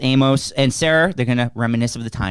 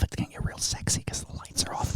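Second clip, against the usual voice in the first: whisper; 15 dB quieter than usual. Acted. Teasing whisper about the dark.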